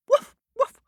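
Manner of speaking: high, loud yapping woofs; yappy barks. Acted. The kitten barking at the farm animals, high and loud. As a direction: bark like a small dog. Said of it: "said the kitten".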